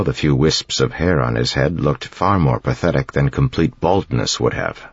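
plain reading voice

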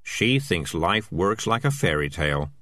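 usual speaking voice

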